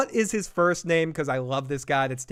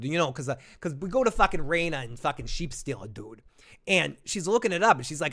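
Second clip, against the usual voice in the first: hammed-up Boston townie accent. Streamer recaps Rhaena and Sheep Stealer, mock Boston accent. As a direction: retell it in broad Boston slang. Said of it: "put on my Boston accent".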